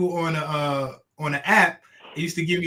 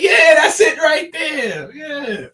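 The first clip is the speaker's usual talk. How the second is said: excited; 11 semitones higher, 7 dB louder, slower than usual. Retold or imitated. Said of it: "I'm like".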